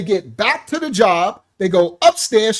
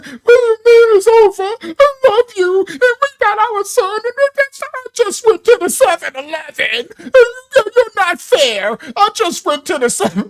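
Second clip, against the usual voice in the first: high-pitched, louder wailing plea; mock crying; 12 semitones higher, 5 dB louder than usual. Girlfriend pleading over the phone, mock sobbing wail. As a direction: wail it like a hammed-up breakdown. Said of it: "And she's crying".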